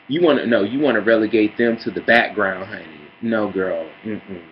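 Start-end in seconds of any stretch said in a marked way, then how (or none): none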